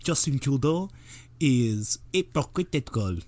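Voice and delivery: put-on a light French accent, slow on is hypocritical. Quoting Maxime Bernier on a YouTube show, light French accent. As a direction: imitate a Québécois politician's French accent. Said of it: "That's his French accent".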